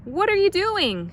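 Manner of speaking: high alarmed shout. A narrator's reading. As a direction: yell it from the driver's seat. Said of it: "shouted Mister Watson".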